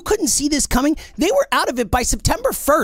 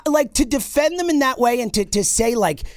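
usual speaking voice

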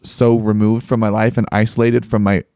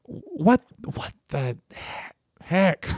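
high, softer exasperated "what the heck"; a bewildered, hushed outburst; retold stunned confusion; baffled; 8 semitones higher, 9 dB quieter, much slower than usual.

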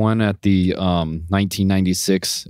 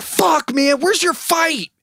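squeaky incredulous lawyer impression; exasperated lawyer; slower than usual. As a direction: do the fed-up lawyer way up high. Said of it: "He's like".

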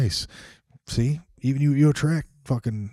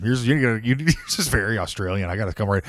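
usual speaking voice

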